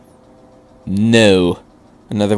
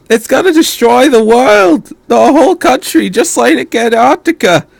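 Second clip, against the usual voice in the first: put-on high, strained boy's voice close to tears; a frightened young boy, much higher and louder, spiralling into panic; distraught teenage boy; 11 semitones higher, 6 dB louder than usual. A narrator's reading. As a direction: voice Shinji panicking over his fault. Narrator voices distressed Shinji.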